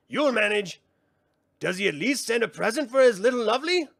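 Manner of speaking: high, theatrical sprite voice; mocking brownie. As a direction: tease him with mock ceremony. Brownies needle Willow.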